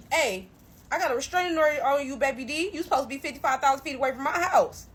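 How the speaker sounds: loud, fast, heated warning; retold yell. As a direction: tell him off loud and quick. Yelling at ex about restraining order.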